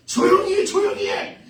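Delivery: high, loud scolding shouts; acted angry mob. Crowd turning on two blind men, acted angry shouting. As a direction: bark it like a swarm of annoyed bystanders. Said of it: "수많은 사람들이 막 벌떼처럼 일어나가지고".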